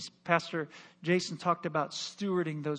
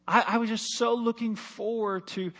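usual speaking voice

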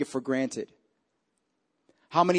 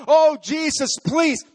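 high, louder cry of begging; desperate plea; 11 semitones higher, 5 dB louder than usual. Acted. Preacher voicing desperate believers crying to Jesus, higher and louder.

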